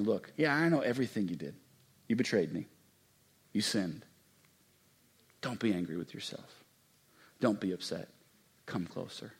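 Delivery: gentle hushed reassurance; tender God's voice. Acted. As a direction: comfort them quietly. God speaking tenderly.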